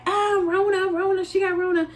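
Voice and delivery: high, teasing gossip voice; sing-song she got Rona taunt; mock gossip. Coworkers gossip that she has Covid, mock teasing. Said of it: "didn't want anybody to be like".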